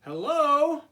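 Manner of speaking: high, loud bright greeting from Frog. Acted. Frog greeting Toad at his door, high and loud. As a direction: sing out Hello as Frog.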